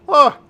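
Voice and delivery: loud, high "Ah!"; bright exclamation "Ah!". Acted. Donald brushes off her worry.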